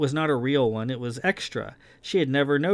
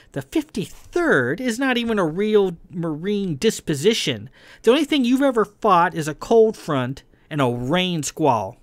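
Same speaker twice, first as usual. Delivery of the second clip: raised-pitch, emphatic ridicule; scornful taunt; 6 semitones higher than usual. A narrator's reading. Kai ridiculing Verlin as no real Marine, scornful. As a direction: belittle the rival's record.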